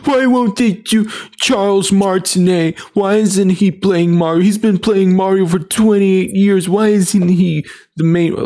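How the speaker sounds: high, loud, whiny Twitter fan; mock whiny fan. Mocking angry fans on Twitter in a high, loud whine. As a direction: squeal it like an outraged fan. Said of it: "That's the kind of reactions we're getting on Twitter".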